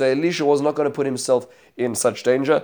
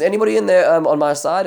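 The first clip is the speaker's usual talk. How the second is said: much higher and louder, shouting up to a window; calling up; 6 semitones higher, 6 dB louder than usual. Acted. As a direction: call up to the people inside the palace. Yehu calls up to the window.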